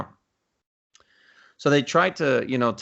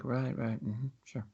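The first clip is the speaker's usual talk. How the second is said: quiet, dry, disbelieving mm-hmm; muttered sarcastic agreement; sarcastic murmur; 11 dB quieter, slower than usual. Retold or imitated. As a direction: humour the scammer under your breath. Sceptical reply to scammer.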